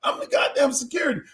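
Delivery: a jittery guard working himself up to step in; panicky usher. Retold or imitated. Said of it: "The usher like".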